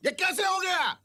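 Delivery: high, sharp call of outrage; outraged demand. Acted. Father-in-law confronting son-in-law, outraged. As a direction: call him to account at a high pitch.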